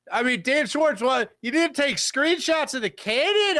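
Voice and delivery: high, incredulous question; playful outrage; mock disbelief. Real, not acted. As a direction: act shocked at the claim.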